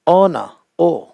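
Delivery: ऑन opening on the O vowel, no H; silent H. Shown as a demonstration. Teacher correcting honour, silent-H ऑन. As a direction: model honour as ऑन, ओ without any H. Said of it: "नहीं होता".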